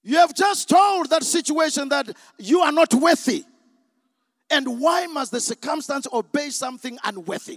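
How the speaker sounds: loud, impassioned preacher's challenge; full-force preaching. Real, not acted. Preacher rebukes doubters in the congregation, at full force. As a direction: drive it home to the whole congregation.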